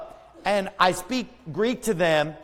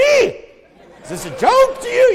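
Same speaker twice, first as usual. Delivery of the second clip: high, loud mock outrage breaking into laughter; mock indignant; 8 dB louder, slower than usual. The preacher mocks his Greek listeners, laughing. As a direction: ham up the offense and laugh through it.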